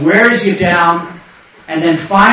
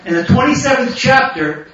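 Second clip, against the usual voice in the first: ordinary talk, nothing marked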